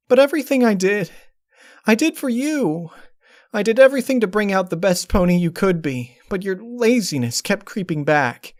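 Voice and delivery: high, loud, desperate cry of a hurt mare; anguished outburst. Acted. Twilight erupts at the fake Flash in anguish. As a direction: throw it at him, pleading and furious.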